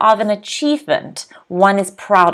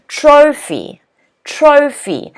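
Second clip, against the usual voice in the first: higher "Trophy", TRO stressed and phy dropping; stress on first syllable; 5 semitones higher, slower than usual. Shown as a demonstration. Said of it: "the first syllable is stressed".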